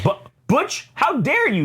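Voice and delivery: hammed-up shocked villain, voice shooting up; a comic villain squeaking with offended pride; mock outraged villain. Voicing outraged villain Owlsley.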